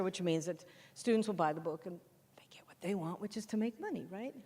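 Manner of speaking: whispered aside. Acted. Presenter confides the profit motive, whispering. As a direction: let them in on the secret, voice gone.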